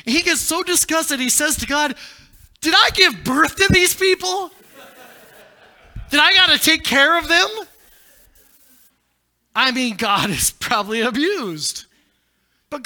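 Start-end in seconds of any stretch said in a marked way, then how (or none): 2.6-7.5 exasperated Moses. Acted. Moses disgusted with the people.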